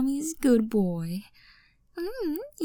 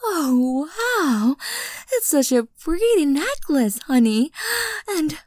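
ordinary talk, nothing marked

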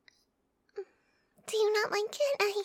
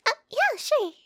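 excited; 4 semitones higher, 7 dB louder than usual. Acted. Happy agreement, suddenly loud.

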